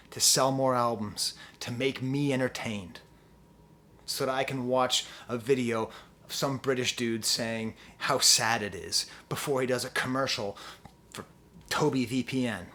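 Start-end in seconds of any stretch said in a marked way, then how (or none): none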